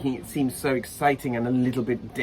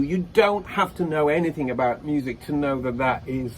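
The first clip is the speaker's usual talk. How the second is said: ordinary talk, nothing marked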